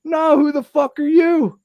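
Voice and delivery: imitating a bewildered friend; baffled Joe. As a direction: squeak out the question in confusion. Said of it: "He goes".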